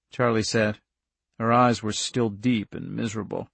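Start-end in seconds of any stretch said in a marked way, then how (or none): none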